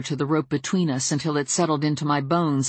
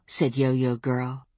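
ordinary delivery

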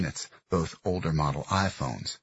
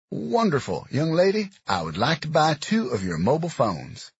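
gruff Southern man; 10 semitones higher, 5 dB louder than usual. Acted. Dox addressing the girls.

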